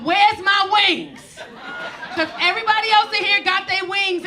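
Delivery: loud, high panicked yell. Retold or imitated. Yelling on plane, retold.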